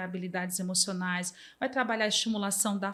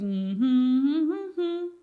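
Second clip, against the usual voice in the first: humming along; 5 semitones higher, 5 dB louder, much slower than usual. Retold or imitated. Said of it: "E eu fico".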